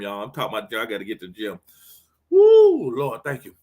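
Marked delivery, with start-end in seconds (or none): 2.3-2.9 high loud whoop of joy; excited woo. Real, not acted. Pastor fired up about the gym, loud whoop.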